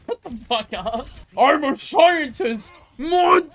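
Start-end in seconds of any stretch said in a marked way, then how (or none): 1.4-3.5 mock shout. In-game shouted catchphrase.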